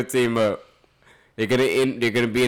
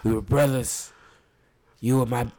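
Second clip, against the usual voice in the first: put-on British accent Idris. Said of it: "Let me try to do Idris".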